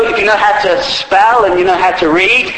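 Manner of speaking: ordinary talk, nothing marked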